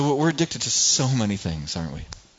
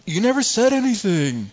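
put-on much higher and louder, whining child; whiny kid protest; 8 semitones higher, 4 dB louder than usual. Kid telling dad he never called, whiny protest. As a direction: protest innocence like a sulky kid.

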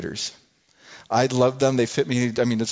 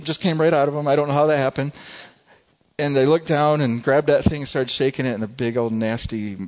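ordinary talk

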